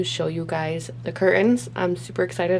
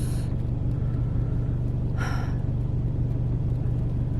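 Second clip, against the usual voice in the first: heavy sigh. Real, not acted. After argument with husband.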